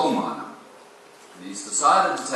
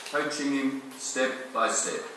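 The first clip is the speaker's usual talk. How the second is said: ordinary talk, nothing marked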